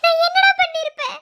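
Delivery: high, loud, choked-up reproach; emotional friend scolding through tears; tearful scolding. Acted. Scolding friend after suicide attempt.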